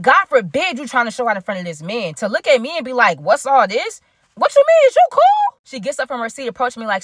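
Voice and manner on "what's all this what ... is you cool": squeaky, much higher, louder mock voice. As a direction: parody her snooty confusion, shrill and loud. Mocking ex's fake confusion.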